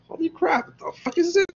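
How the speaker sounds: very high squeaky mock amazement; amazed disbelief. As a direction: play astonishment in a falsetto squeal. Acting cave man seeing a squirrel.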